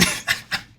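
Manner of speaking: voiceless laugh of disbelief; amused laugh. Real, not acted. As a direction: crack up without voice. Laughing at the replayed clip.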